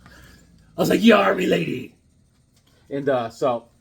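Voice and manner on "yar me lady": loud hammed-up pirate greeting. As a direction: hail the lady like a mock pirate. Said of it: "she looked like a pirate".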